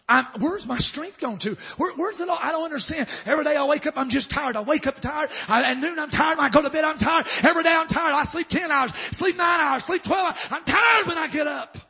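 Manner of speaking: high, loud, mounting rant; acted exasperated complaint. Preacher voicing someone tired every day, loud and exasperated. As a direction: pile up the complaints, building to a shout. Acts exhausted person's frantic complaint.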